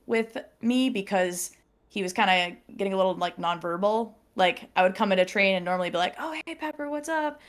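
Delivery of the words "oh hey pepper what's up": high, soft imitation of a friendly hello; lightly acted, high-pitched greeting on walking in. Retold greeting on coming in.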